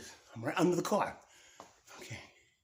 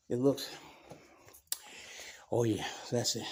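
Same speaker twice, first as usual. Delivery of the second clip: ordinary delivery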